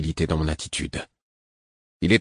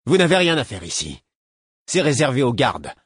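scornful; 6 semitones higher than usual. Acted. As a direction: play to the crowd, sneering. Guard showing off to comrades.

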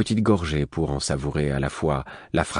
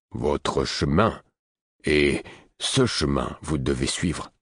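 put-on low and slow, weighty old man; slow grave hermit; 3 semitones lower, slower than usual. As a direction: draw out "chemin" slowly and gravely. Host speaks gravely.

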